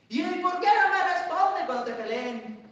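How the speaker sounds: high, loud, slow mocking taunt. Preacher mimics the devil's taunt, high and loud. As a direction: goad them into answering.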